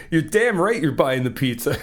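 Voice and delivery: ordinary delivery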